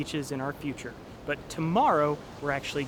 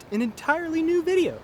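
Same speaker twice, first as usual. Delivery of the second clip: excited; 13 semitones higher, 4 dB louder than usual. Real, not acted. Upbeat promo for next video.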